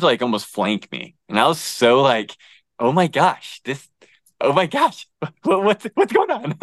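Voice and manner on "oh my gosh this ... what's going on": rising, breaking into a nervous laugh; startled disbelief. Retold or imitated. Retelling the cougar flanking him, high startled disbelief. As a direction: voice climbing high in shock at the cougar.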